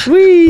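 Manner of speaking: high loud gleeful whee. Pretend. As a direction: squeal it with joy. Playful shout.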